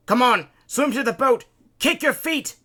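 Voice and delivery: high, loud urging; urgent shouting. A narrator's reading. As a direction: push the swimmer on with shouts. Urging a swimmer to the boat.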